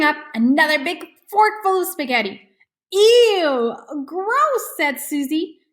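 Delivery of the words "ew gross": disgusted. Acted. Sister disgusted by slurping.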